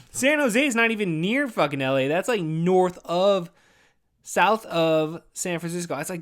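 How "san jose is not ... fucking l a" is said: normal talking voice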